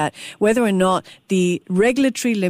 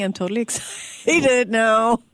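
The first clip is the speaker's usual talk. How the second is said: playful excitement. Real, not acted. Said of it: "You've excited me".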